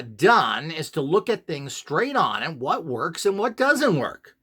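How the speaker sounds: usual speaking voice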